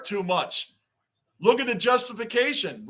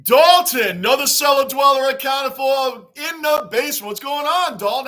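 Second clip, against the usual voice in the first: loud, high hype shout; loud greeting; 5 semitones higher, 7 dB louder than usual. Real, not acted. Host shouting a welcome to a viewer, loud and pumped. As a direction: call the name out to the whole room.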